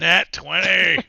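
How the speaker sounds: very loud, high, drawn-out cheer; excited shout. Real, not acted. Game master celebrating a lucky roll, triumphant shout. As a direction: yell it in triumph, stretching it out.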